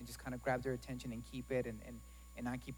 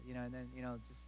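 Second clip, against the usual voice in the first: usual speaking voice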